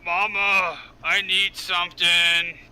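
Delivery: loud, slow, drawn-out call for Mama; creature at the door. Acted. Game master voices creature pounding on the door, loud slow creature voice. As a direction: bellow it slowly like a needy creature. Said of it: "and you hear this voice".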